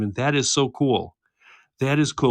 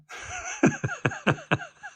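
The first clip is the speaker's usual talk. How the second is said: high laugh; 5 dB quieter than usual. Real, not acted. Host cracks up at chat joke.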